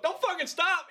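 high, urgent mock order; Nick Fury. Commander urges the praying brother on, mock urgent. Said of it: "he goes".

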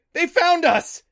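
high, loud shout of alarm; full-voiced, high-pitched alarm; panicked cry. A narrator's reading. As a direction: cry out in panic as they close in. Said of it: "he cried".

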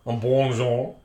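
a hint of a French accent on un bonjour; mock French greeting. Podcaster greeting imagined French listeners in mock French. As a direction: greet the French listeners with a light put-on French lilt. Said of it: "Have we got a few French listeners?".

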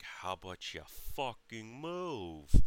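slow, emphatic mock demand; exasperated retold order. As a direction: lean hard on the swearing, fed up. Retelling his exasperated order to mom.

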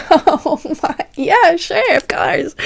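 high flustered reply; mock flustered high voice; flustered high squeak.

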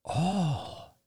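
put-on soft, high, drawn-out child's voice; hushed awe, pitch lifted high; wondering little boy. A narrator's reading. Son stares at scattered candy, wondering. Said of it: "repeated Ben, staring at the scattered candy".

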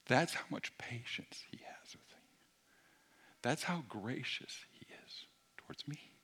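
whispered, reverent sermon lines; hushed awe. Acted. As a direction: breathe it out in wonder. Intimate whispered preaching.

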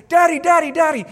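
put-on excited child calling. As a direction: call out like an eager kid. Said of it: "he goes".

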